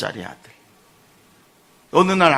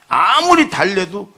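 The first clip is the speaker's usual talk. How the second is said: ordinary talk, nothing marked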